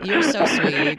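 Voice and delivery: crying host thanking the hosts; teary laugh. Real, not acted. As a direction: laugh it through tears. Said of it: "made me cry".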